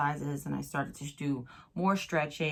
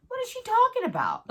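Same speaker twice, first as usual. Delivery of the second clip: high-pitched, louder mock bewilderment; baffled disbelief; 5 dB louder than usual. Patient retells reading her friend's text, baffled. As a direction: squeal it in comic confusion.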